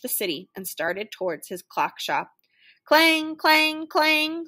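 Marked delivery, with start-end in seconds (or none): 2.8-4.5 high, ringing clangs; raised, evenly pitched metallic clanging; screeching trolley clang. A narrator's reading. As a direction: ring out each clang like a trolley bell. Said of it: "screeched the trolley car".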